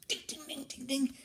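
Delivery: very high dings; hammer dings. Acted. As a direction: tap out the last dings. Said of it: "Grandpa Cochran goes".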